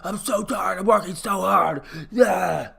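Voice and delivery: slow, weary, panting player voice; hammed-up exhausted athlete; worn-out athlete groaning after practice; mock tired football player. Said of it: "football players come off the sideline".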